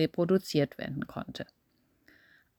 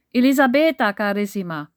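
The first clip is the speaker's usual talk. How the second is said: almost screaming; 2 semitones higher, 6 dB louder than usual. Acted. Massimo almost screams her name at the dinner table. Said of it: "Wieder schrie Massimo geradezu den Namen".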